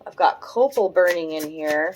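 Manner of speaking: usual speaking voice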